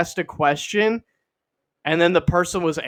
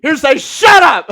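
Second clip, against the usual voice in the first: higher, louder shout through laughter; laughing shout; 11 semitones higher, 12 dB louder than usual. Retold or imitated. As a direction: yell it while cracking up. Mock lawyer shouting, laughing.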